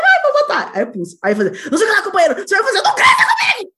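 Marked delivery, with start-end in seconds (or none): 0.0-0.7 high, loud, eager mock decision; loud, high-pitched enthusiasm; re-enacted eagerness. Re-enacts choosing the novelty GPS voice. 1.7-3.7 loud, high shouted politician impression; mock shouting GPS voice, laughter underneath; Lula. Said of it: "botou com a voz do, do Lula".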